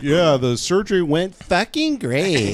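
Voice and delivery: slow cowboy drawl; John Wayne drawl. Retold or imitated. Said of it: "they're like".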